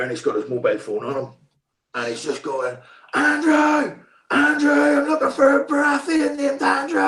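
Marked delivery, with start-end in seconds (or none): 3.1-7.0 high, loud mock yell across a set; assistant director calling out. Assistant director hunting for an extra, shouting high. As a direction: bellow a name, searching the crowd. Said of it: "the assistant director runs out of nowhere".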